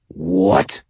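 high, sharp inhuman snap; startled monster. A narrator's reading. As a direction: caught off guard, creature voice. Skinwalker startled by refusal.

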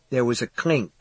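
usual speaking voice

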